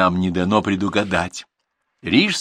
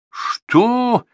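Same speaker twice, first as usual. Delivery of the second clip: a high, loud outraged what; indignant shout; 7 dB louder than usual. A narrator's reading. He is outraged by her words, loud high shout. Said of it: "возмутился он так громко".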